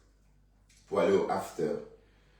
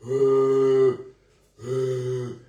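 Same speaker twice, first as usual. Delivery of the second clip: high silly grunts; 5 semitones higher, 9 dB louder than usual. Pretend. Odd high put-on noises to dog.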